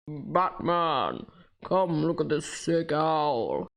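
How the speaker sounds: a lightly voiced low villain, slow; Bane impression. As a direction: drawl it like a hint of Bane. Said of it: "this is my Bane impression".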